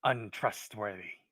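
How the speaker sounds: loud, slow kobold with heavy pauses; distrustful kobold. Acted. Kobold describes the outcast to the adventurers, loud distrust. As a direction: drop each damning description separately and forcefully.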